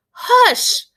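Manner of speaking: high, sharp hush. A narrator's reading. Doctor silences the pestering boy sharply. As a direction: cut him off.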